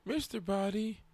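high-pitched imitation of a kid. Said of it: "This kid is like".

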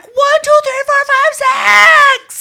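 high, screamed count; hollered back at full volume; loud as hell. Retold or imitated. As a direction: yell each number across a pool. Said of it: "Loud as fuck".

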